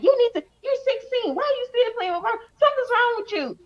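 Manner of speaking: high-pitched scolding mother imitation; mad mom. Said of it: "she would get mad about that".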